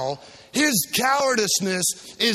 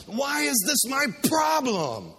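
slow, drawn-out cry of frustration; exasperated shout; 5 semitones higher, slower than usual. Acted. Preacher playing Pilate crying out, exasperated. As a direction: hurl the question out.

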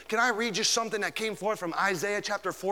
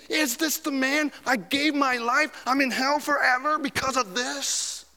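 high, anguished disbelief; hammed-up dread, pitched high; horrified regret; 7 semitones higher than usual. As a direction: voice a soul's despair in hell. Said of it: "you'll be thinking".

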